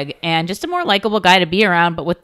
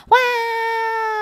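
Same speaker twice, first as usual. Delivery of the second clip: put-on high, drawn-out childish wow; mock kids' wow. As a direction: gasp like awed children. All the kids go.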